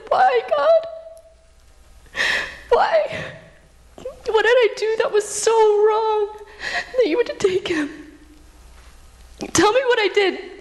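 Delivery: high, breaking voice, sobbing laughs between pleas; crying plea. Retold or imitated. Grieving widow pleading with God for answers, sobbing. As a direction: beg God through tears. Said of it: "began to cry and to cry".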